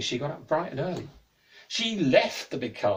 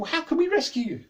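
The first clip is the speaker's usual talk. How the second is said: put-on anxious girl; 12 semitones higher, 5 dB louder than usual. Girl pleading in alarm.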